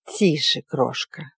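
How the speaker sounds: put-on low soft hush to a crying girl; soothing a child. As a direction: hush her gently, low and quiet. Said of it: "шептала женщина".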